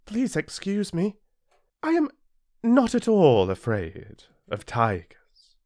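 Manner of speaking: high, dainty flower; sweetly polite, a touch vain; lightly voiced proud flower. A narrator's reading. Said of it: "the flower replied sweetly".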